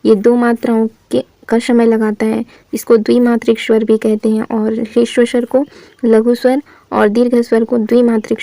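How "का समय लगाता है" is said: ordinary talk, nothing marked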